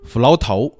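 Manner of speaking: 福劳头 as Vietnamese for 福禄寿; Vietnamese pronunciation. Shown as a demonstration. Host cites a Vietnamese mall name, 福劳头 in Vietnamese pronunciation. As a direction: say 福劳头 the Vietnamese way, "fu lao tou". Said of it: "越南话叫做".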